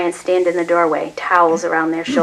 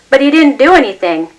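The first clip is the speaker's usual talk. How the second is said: higher, louder indignant outcry; loud teen protest; 8 semitones higher, 8 dB louder than usual. A narrator's reading. Son protesting to the police over his father, loud outcry. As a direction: protest the injustice.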